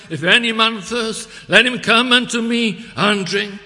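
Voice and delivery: high, very loud proclamation; calling out. Retold or imitated. Preacher relays Christ's call to souls present, calling out. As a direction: ring the invitation out to every listener. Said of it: "he was calling to them".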